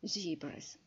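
"zebras" with long ee, ZEE-bras; American zebras. Shown as a demonstration. Teacher demonstrates American "zebras", long ee. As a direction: say "zebras" American style, ee as in see. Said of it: "Американський варіант".

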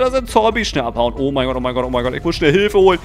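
usual speaking voice

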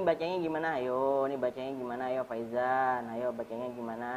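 low, soft coaxing; gentle coaxing. Real, not acted. Teacher urging young pupil to read, gently coaxing. Coaxing a child who starts crying.

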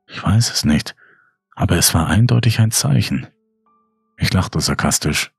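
ordinary delivery, nothing marked

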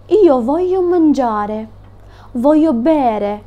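very slow, deliberate Italian; slow example. Shown as a demonstration. Teacher giving a slow Italian example for students. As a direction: spell out each word slowly. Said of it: "Per esempio".

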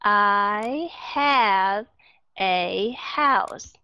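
very slow, word by word; slow reading. Shown as a demonstration. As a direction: stretch each word, leaving gaps between them. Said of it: "Từ từ đọc". (đọc thật chậm, từng chữ một)

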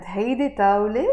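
fast, طاولة rising at the end; question intonation. Shown as a demonstration. Teacher labels this take a question. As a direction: sing the rise on طاولة. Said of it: "that's a question".

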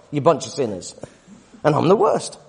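normal talking voice

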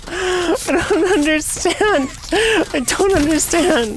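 high, slow put-on whine; whiny put-on voice. Streamer whining to chat about the broken game, high and slow.

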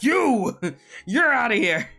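mock yell. Mock exiling a lurker.

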